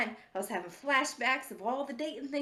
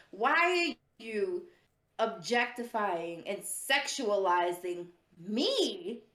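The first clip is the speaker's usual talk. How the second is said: slow, drawn-out emphatic questioning; deliberate, word-by-word outrage; indignant; much slower than usual. Real, not acted. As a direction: stretch each key word in disbelief. Indignant complaint to the audience.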